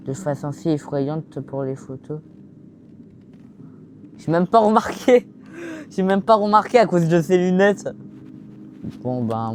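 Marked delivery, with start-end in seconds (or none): 4.2-7.9 excited. Real, not acted. Laughing at a creepy photo.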